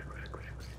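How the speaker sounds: breathless whispered urging; tense whisper, willing the character to run; urgent whisper. Real, not acted. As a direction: hiss the words under your breath.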